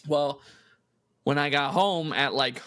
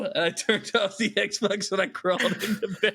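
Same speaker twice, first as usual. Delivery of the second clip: ordinary talk, nothing marked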